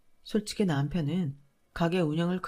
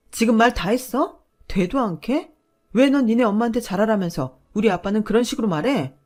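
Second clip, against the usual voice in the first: loud, higher angry shout; wife defending her father against the husband, shouting; furious retort; 4 semitones higher, 9 dB louder than usual. Retold or imitated. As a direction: hurl it back at him, incensed.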